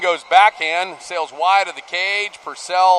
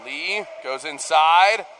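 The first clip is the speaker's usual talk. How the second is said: normal talking voice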